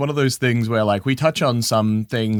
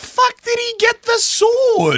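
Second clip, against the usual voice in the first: much higher, louder disbelieving shout; incredulous; 4 dB louder than usual. Real, not acted. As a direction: blurt it out in disbelief. Incredulous outburst.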